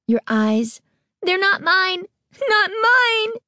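rising to a high panicked cry; frantic cry. A narrator's reading. Girl denying her eyes, rising high frantic cry. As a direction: build from frantic to shrieking. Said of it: "Carly Beth cried".

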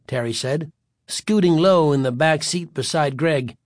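plain reading voice

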